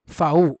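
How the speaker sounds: फाउ called out loud as "fow"; loud German letter V. Shown as a demonstration. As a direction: punch फाउ out loudly for the class to repeat. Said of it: "German में वी को क्या बोला जाता है".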